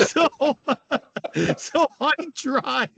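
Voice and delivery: usual speaking voice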